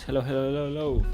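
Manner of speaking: normal talking voice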